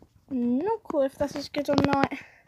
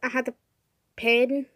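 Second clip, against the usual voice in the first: talking around a pen in mouth; words muffled by a pen in mouth. Real, not acted. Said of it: "pen in my mouth".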